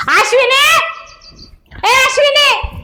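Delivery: slow, drawn-out call across the house. Acted. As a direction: call her name from another room. Mother-in-law calling out.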